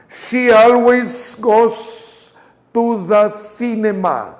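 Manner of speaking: slow, with the breath held through "always"; sustained voice. Shown as a demonstration. Teacher asks the class to sustain the voice. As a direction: hold the voice steady and stretch it out. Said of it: "sostengan la voz".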